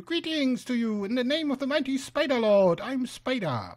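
solemn greeting in a high voice; shrill would-be somber spider. Acted. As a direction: try to sound grave with a high-pitched voice. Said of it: "trying to be somber, but the shrill, high-pitched voice".

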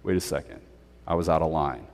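a hint of sheepish retreat; humbled hush. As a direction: back down, chastened. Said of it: "everybody just kind of shuts up".

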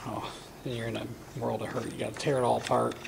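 normal talking voice

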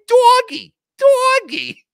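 very high, loud silly voice; Opie. Acted. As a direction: squeal it like a ridiculous impression. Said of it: "with his incredible impressions".

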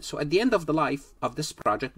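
ordinary talk

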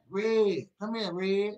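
loud, high shout for Red; mock calling out. God calling a stranger, mock loud. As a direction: call out carelessly. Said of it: "He ain't over there doing that".

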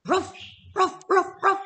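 yappy mock barking; dog barks. Teacher voicing the grumpy dog for the class, mock barks.

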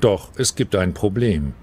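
ordinary talk

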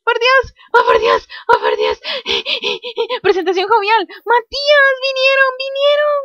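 high, loud squeals of excitement; overexcited. Real, not acted. Gamer tells Matías the aliens came, wildly excited. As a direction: squeal it, beside yourself with excitement. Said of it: "me emocioné muchísimo".

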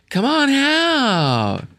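very high, slow drawn-out holler; hammed-up slow, high-pitched bellow; mock giant's call. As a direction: stretch each phrase out high like a far-carrying call. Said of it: "says something really loud and threatening".

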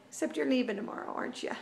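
ordinary talk

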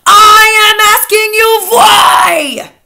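high, very loud, drawn-out shriek; screamed. A narrator's reading. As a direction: scream each word slowly as a raging witch. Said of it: "she screamed".

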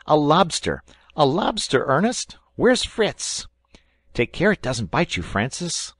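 put-on high, loud boyish crowing; boy boasting of his catch to the family, high and loud; proud excited boy. A narrator's reading. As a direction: show off the lobster like a thrilled child. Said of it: "said he proudly".

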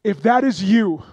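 high, loud, fervent preacher's cry; full-force preaching. Acted. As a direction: preach it out with everything you have, building to the altar call.

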